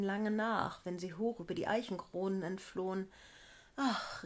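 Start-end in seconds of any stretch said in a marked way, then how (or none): 3.8-4.2 high wistful sigh of a word; yearning outcry; a girl dreaming of flight; longing cry. A narrator's reading. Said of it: "rief es".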